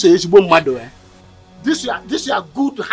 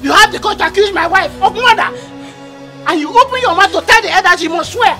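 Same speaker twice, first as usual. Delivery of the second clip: angry threat; 6 semitones higher than usual. Acted. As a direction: drive her out of the house. Man throws accuser out.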